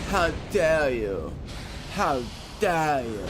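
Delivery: slow, mildly mocking outrage; a light hint of the climate activist's speech; global warming girl. Imitating that global warming girl's famous line, slow and lightly mocking. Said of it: "Where she was like".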